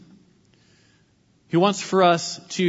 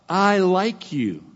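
drawn out slowly and kindly; slow, sincere little compliment; tender and slow; 4 semitones higher, much slower than usual. Shown as a demonstration. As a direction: offer it gently, like a gift. Preacher models a kind phrase.

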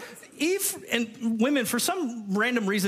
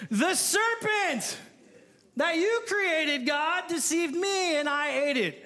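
high, slow whiny excuse; whining Eve; 6 semitones higher, slower than usual. Acted. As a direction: play Eve pleading and blaming. Eve shifting blame.

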